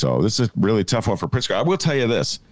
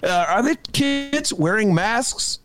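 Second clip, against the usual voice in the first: a hammed-up, much higher on-the-scene reporter intro; mock roving reporter; 7 semitones higher than usual. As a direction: play a mock field reporter checking on schools. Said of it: "Can you see Dennis?".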